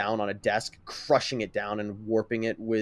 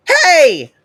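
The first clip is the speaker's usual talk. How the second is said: sharp, high, loud shout across the room; indignant yell to stop him; 15 dB louder than usual. Acted. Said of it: "Ochako yells from the other side of the room".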